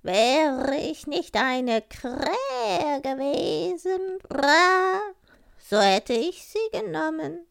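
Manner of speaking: high, slow crow voice with loud caws; raspy cawing crow. Acted. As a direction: croak it proudly like a talking crow. Crow speaks in the tale.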